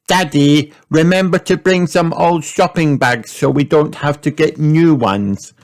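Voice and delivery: a bossy little reminder to dad; girl reminding her father; Peppa reminding Daddy. A narrator's reading. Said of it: "said Peppa".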